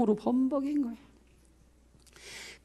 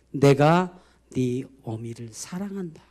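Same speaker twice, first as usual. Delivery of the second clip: God's gentle voice; 6 semitones lower, 3 dB quieter, slower than usual. Retold or imitated. Voicing God's gentle answer.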